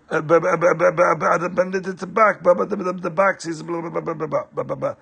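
embarrassed stammer trailing into mumbled blah. Acted. Husband stalls when wife asks about box, stammering. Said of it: "so he started, like, stammering".

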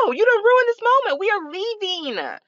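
high-pitched, fed-up mom voice; high, emphatic retold telling-off; mock scolding parent. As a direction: imitate a parent laying down the law. Retells telling crying son off.

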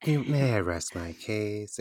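softly imitating a very zen teacher; chill teacher. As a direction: play the endlessly patient teacher, softly. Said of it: "she was so chill".

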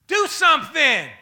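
shouted high and hard; loud and high. Shown as a demonstration. Neighbor yelling the phrase at the next person. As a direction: bark it out as a command. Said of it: "don't tell him like that".

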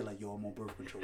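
low, very soft murmur; whispering in ear. Acted. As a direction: whisper it like pillow talk. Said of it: "about the whispering in her ear".

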